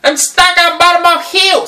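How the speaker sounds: high, loud panicked broken English; stranded delivery guy yelling for help in a put-on accent; mock screaming delivery man. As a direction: squeal for help as the stuck driver. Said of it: "he starts screaming at me".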